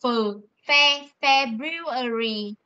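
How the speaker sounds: February with a clear f, slowly; slow model pronunciation. Shown as a demonstration. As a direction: build Phờ, Fe into February slowly. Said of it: "P H E trong tiếng Việt ấy".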